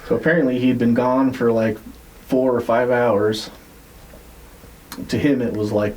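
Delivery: ordinary delivery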